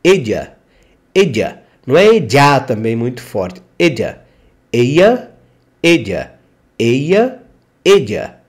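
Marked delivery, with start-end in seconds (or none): 0.0-1.6 ella said like "edja", ll as a J; teacher showing the J-like ll; ella with a J sound. Shown as a demonstration. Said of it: "Como se fosse um J". 3.8-8.4 ella alternating "edja" and "eya"; teacher contrasting the two ll sounds; two ways of saying ella. Shown as a demonstration. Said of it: "você vai ouvir as duas pronúncias".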